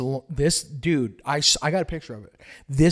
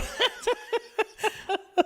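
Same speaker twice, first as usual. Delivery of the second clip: high wheezing laugh; 4 dB quieter than usual. Real, not acted. Laughing at own story.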